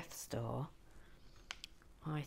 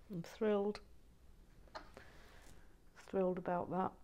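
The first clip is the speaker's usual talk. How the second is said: light Canadian accent on thrilled; a playful go at sounding Canadian; thrilled said with a mild Canadian twang; 3 semitones higher than usual. Shown as a demonstration. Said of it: "with my Canadian accent yesterday".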